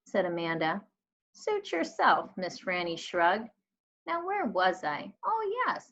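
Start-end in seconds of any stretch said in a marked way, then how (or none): 1.3-2.4 high, slow, airy dismissal; unbothered librarian to a haughty girl; breezy shrug. Acted. Miss Franny shrugging off Amanda. 4.1-5.9 high, sing-song remembering; old librarian finding her thread again; lilting recall. Acted. Miss Franny recalling her place.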